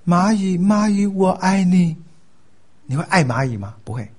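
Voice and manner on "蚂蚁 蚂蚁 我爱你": loud, high, chanted declaration of love; mock-declare love to an ant, high and even; Buddhist addressing ants. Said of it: "但一个佛教徒会对蚂蚁说".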